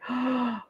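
quick high, soft gasp; re-enacted gasp of excitement; excited gasp. Co-host retelling their reaction to the twist, excited gasp.

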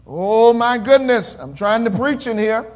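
normal talking voice

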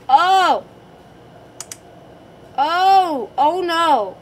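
loud, high shocked cries; startled gasp. Real, not acted. Gasp at jumpscare.

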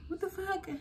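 confused, high and soft; dazed on the ground, high confused squeak; high bewildered what the fuck. Retold or imitated. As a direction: squeak it out in disbelief. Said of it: "I'm like".

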